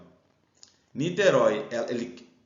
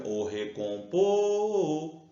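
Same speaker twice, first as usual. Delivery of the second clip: "recompor" with a long held final "por"; calling the word; 4 semitones higher, much slower than usual. Shown as a demonstration. Teacher calls the word to find its stress, drawn-out "por" in "recompor". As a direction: call out "recompor", stretching the last syllable. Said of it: "é o pô".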